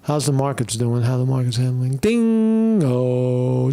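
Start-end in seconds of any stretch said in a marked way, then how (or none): 2.0-2.8 bell ding imitation. Mimicking the market opening bell.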